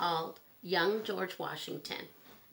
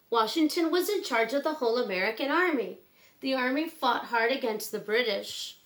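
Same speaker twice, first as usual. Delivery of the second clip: clear, gentle storybook read-aloud; reading to children; 4 semitones higher, 6 dB louder than usual. Acted. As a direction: read it like bedtime story time for kids. Reads picture book to children.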